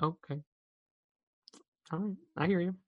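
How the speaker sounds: very soft, resigned retold reply; meek acceptance. Student told one pizza per person, meekly accepting. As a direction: back down quietly. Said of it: "I'm like".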